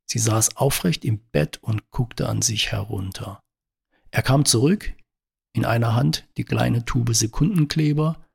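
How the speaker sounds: usual speaking voice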